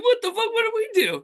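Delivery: squeaky-high indignant pleading; mock baffled protest. Voices the group's bewildered protest.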